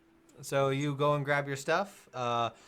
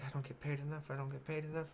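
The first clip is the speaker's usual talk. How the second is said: muttering under breath; 12 dB quieter, much faster than usual. Acted. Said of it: "She's just like".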